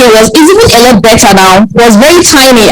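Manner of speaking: ordinary talk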